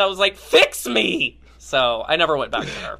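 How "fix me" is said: high, exasperated outburst; mock desperate plea. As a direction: cry out for help, hammed-up. Acting out her desperate plea to therapist.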